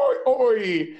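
high, badly faked English accent; Neil Gaiman accent. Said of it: "this is my Neil Gaiman accent".

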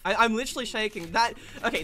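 usual speaking voice

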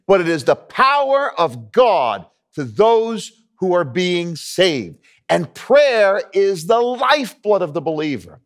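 high, slow, ringing proclamation; emphatic preaching. Acted. Preacher proclaiming the power of God to the congregation, emphatic and drawn out. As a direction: preach it forcefully, drawing out the key words. Preaching rises to full force.

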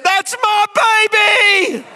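high, loud cheering cry; proud wife cheering from the crowd; joyful shout. Retold or imitated. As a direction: shout it out with pride. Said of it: "she kicked her arms out, and she said".

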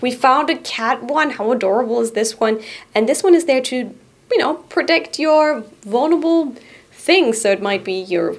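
ordinary delivery

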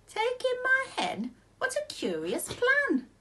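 put-on high, slow, doubting old lady; puzzled old lady. A narrator's reading. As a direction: squeak it out in disbelief, slowly.

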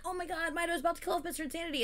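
lightly acted breathless excitement; mock excited reaction. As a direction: rush it out like a hyped viewer. Voicing an excited viewer's reaction.

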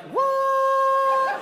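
high shouted what; shocked what. Retold or imitated. Friend blurting out in disbelief, high shout.

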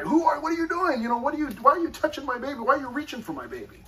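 high-pitched, rapid-fire protective parent; alarmed challenge. Acted. Parent to a stranger reaching for the baby, alarmed challenge. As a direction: fire off panicked questions at a stranger, acting it out.

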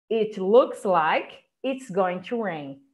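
"It looks like it's going to rain" said slowly; slow model sentence. Shown as a demonstration. Teacher joins both phrases slowly for the class. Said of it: "juntar com essa".

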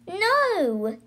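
put-on understated girl's scream of protest; mild scream. A narrator's reading. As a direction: cry out high, holding back the volume. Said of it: "screamed Zoe".

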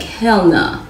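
ordinary talk

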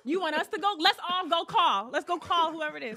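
high, loud insistence; retold alarmed protest. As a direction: push it high and loud, reenacting panic. Acting out her alarmed protest.

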